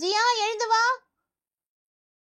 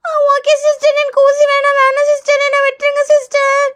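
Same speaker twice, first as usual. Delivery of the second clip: put-on high child voice, whining to be spared; tearful pleading; 6 semitones higher, 3 dB louder than usual. Child begs nurse to skip the injection, tearful pleading. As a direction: beg like a kid about to cry. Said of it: "நீ எதுக்கு இப்பவே அழுவுற?".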